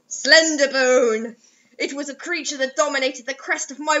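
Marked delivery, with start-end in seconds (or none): none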